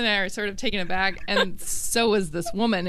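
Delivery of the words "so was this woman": ordinary talk, nothing marked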